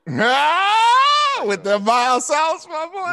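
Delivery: high, loud cry, dragging out the sauce line; mocking shout. Real, not acted. Teasing his friend about mild-sauce tacos, mock shout. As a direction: clown his taco pick with a hammed-up yell.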